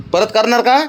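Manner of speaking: playful stern parent; mock-stern questioning. Real, not acted. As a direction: press him for an answer, half-teasing. Father questions child about peeing.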